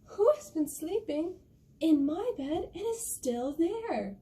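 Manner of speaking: high, wailing little bear, drawn out. Acted. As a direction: whine it high, stretching the last words. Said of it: "And the little bear goes".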